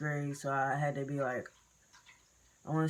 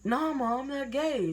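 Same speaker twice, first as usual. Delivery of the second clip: high-pitched kid protest, reenacted; protesting denial; 9 semitones higher, 3 dB louder than usual. Child denying it to mom, imitated high protest. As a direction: deny it in a raised, pleading pitch.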